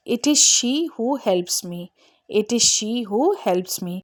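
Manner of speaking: very slow, she weighted; stress on she. Shown as a demonstration. Teacher slowly modelling the cleft for students. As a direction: drag it out word by word, leaning on she. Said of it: "जब हम subject पर जोर देते हैं ना".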